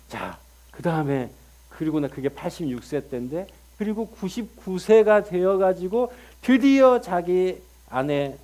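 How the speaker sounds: ordinary delivery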